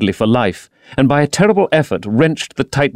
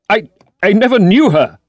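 high, louder panicked stammer; 10 semitones higher, 4 dB louder than usual. A narrator's reading. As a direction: stumble over the words in terror. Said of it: "he stammered".

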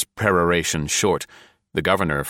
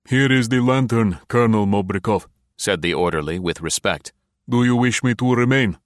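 higher, lightly voiced soldier; a hint of a dutiful orderly; respectful orderly; 4 semitones higher than usual. A narrator's reading. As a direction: speak up deferentially to the colonel.

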